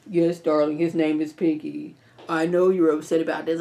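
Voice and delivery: lightly voiced smooth husband; Hunter voice. Hunter playing along with Victoria, low and sweet. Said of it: "That's my Hunter voice".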